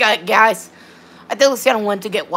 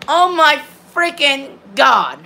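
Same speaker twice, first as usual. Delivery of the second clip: slow, drawn-out frustration; real frustrated groan of words, stretched slowly; exasperated; 3 semitones higher, slower than usual. Real, not acted. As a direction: draw out each word in exasperation. Frustrated by repeated disconnections.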